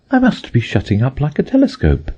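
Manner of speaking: put-on high, wondering girl's voice; Alice, wondering. A narrator's reading. Alice shrinking, high and amazed. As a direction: marvel at the strange feeling.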